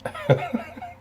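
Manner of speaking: high wheezy laugh, real; short, high, breathless laughing fit; burst of laughter. Real, not acted. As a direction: laugh at what you just watched. Laughing at the clip.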